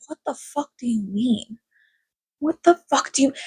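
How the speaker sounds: a breathy, stunned "what the fuck"; stunned disbelief. Retold or imitated. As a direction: react in quiet shock at the phone. Reacting to creepy text.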